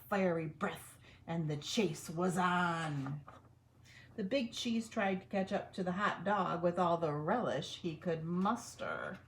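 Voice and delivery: ordinary delivery, nothing marked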